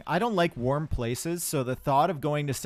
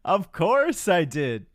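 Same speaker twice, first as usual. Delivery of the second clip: higher, louder, drawn-out mock Donkey from Shrek; 7 semitones higher, 6 dB louder, much slower than usual. Asked if he did the accent, he does Donkey's voice. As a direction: ham up the Shrek Donkey accent, slowing right down. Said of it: "Did you do the accent?".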